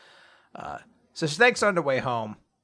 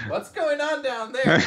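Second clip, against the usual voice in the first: very high, slow, strained voice; hammed-up squeaky bewilderment; mock startled question; 12 semitones higher, slower than usual. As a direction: call out in comic alarm. Acted startled question under table.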